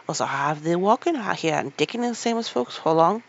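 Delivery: put-on loud, cheery Yorkshire boy; Yorkshire lad calling out heartily. Dickon's Yorkshire voice.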